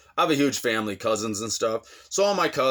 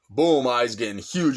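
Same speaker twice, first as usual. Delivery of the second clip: ordinary talk, nothing marked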